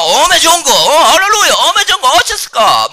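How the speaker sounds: high, loud Jeolla exclaiming; Jeolla dialect. Shown as a demonstration. Preacher acts out fiery regional believers rejoicing, exuberant Jeolla accent. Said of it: "정말 그 전라도 사람들".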